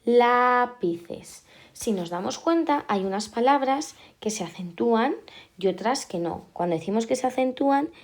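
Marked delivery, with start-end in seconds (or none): 0.0-1.4 LÁpices, a loud, long LÁ; stress on first syllable. Shown as a demonstration. Teacher marking the antepenultimate, lápices stressed on LÁ. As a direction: hit and hold LÁ in lápices. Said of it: "en la antepenúltima".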